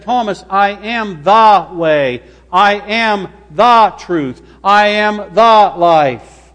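THE drawn out and punched in each phrase; slow down and lean hard on every "the"; stress on THE. Shown as a demonstration. Jesus answering Thomas about the way, stress on THE. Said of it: "What did Jesus say about the?".

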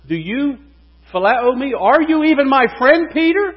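rising, pleading challenge; pressing question. Acted. Jesus presses Peter a third time.

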